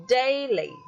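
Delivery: "daily" with DAI stressed, LY light; front stress. Shown as a demonstration. Teacher introducing the trochee, front stress on "daily". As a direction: hit the first syllable of "daily". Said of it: "you give stress at the front".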